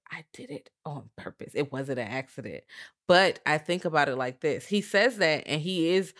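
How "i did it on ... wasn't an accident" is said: very soft, lowered menacing quote; leaning-in confession. Retold or imitated. Podcaster imitating the boy leaning in to confess, hushed. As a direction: drop right down as if confiding closely. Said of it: "he leans in on purpose and says".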